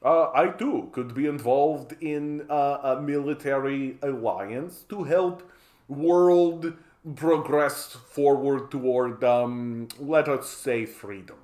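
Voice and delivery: put-on slow, halting diplomat in a Russian accent; earnest pitch in fake Russian accent; mock Russian accent. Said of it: "Russia coming over and being like".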